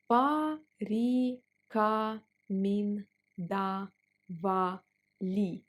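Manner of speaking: порекомендовали drawn out; slow teaching pace on порекомендовали. Shown as a demonstration. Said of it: "Let's pronounce it slower".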